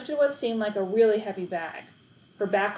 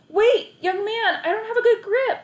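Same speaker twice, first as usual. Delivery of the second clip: put-on high alarmed elderly woman calling after him; old lady calling; 13 semitones higher, 7 dB louder than usual. A narrator's reading. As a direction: call out as a flustered old lady.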